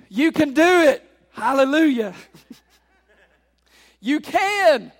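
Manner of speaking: loud, high closing exhortation; forceful preacher's send-off; exultant preaching. Real, not acted. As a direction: call it out to rouse the congregation.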